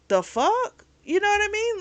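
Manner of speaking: high, loud disbelief; incredulous. Real, not acted. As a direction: react in outrage, pitch jumping way up. Incredulous reaction to his rule.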